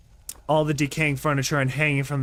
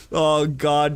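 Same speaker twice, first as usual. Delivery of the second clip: usual speaking voice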